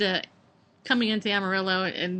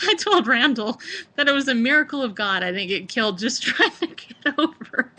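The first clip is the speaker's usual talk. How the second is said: real nervous laughter breaking into speech; higher, giggling through the words; laughing while telling; 5 semitones higher than usual. Real, not acted. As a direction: laugh your way through a scary memory. Laughs recalling scary lane change.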